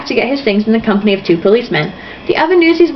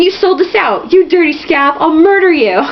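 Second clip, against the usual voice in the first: mock scream; 7 semitones higher than usual.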